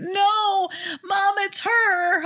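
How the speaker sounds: high, slow insistence; stubborn daughter. Retold or imitated.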